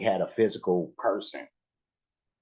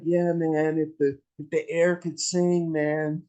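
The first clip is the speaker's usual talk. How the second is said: stoner voice; 10 semitones higher, 3 dB louder than usual. Retold or imitated. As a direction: play it like a chilled-out stoner. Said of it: "how much that sounded like a stoner comment".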